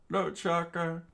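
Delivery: anguished cry. Acted. Dying friend calling out to Chaka in despair. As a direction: call out his name in dismay.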